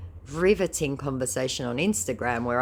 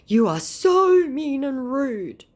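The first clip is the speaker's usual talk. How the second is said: high, loud, slow whiny scammer voice; 11 semitones higher, 8 dB louder, slower than usual. Acted. Scammer complaining she is rude, high slow whine.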